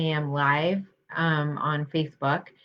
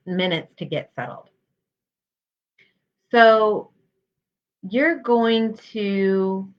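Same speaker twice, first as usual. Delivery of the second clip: guided meditation. Real, not acted. Health psychologist guiding listeners through mindfulness practice, calm guided meditation. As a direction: lead them gently, leaving space between phrases.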